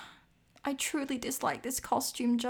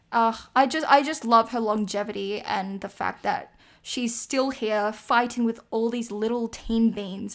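ordinary talk

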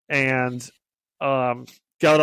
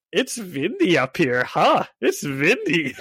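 put-on comic Russian accent, w turned to v; playful imitation of a Russian accent; 3 semitones higher than usual. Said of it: "he's doing it all in, like, a Russian accent".

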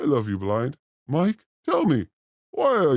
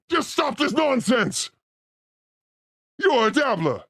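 mock rant; 4 semitones higher than usual. Rant at dabblers.

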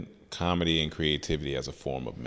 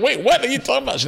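very high, fast, louder question; imitated astonished mom; 5 dB louder, faster than usual. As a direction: play mom reeling in disbelief, pitch way up. Mom's reaction on retold call.

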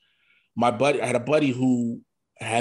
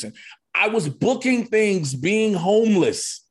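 higher, louder, slowed stress on "booking things"; emphatic irony; 8 semitones higher, 4 dB louder, slower than usual. Real, not acted. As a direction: lean hard into the irony. Stressing irony of success while homeless.

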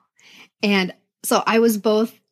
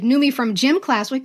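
ordinary talk, nothing marked

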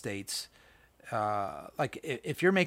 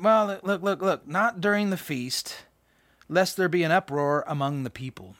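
scripture reading; 4 semitones higher, 4 dB louder than usual. Acted. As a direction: read the passage out with weight. Reads scripture aloud.

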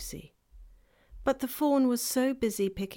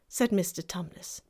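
usual speaking voice

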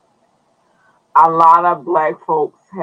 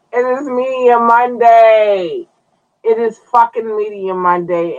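hype announcer; 7 semitones higher, 4 dB louder than usual. Acted. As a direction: announce it like a big show intro. Hyped show opener announcement.

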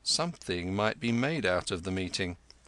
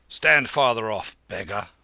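loud, barked command; shouted order; 8 dB louder than usual. A narrator's reading. Hillman ordering a beggar away from the cart, shouted. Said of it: "The order was shouted in broken Hindustani".

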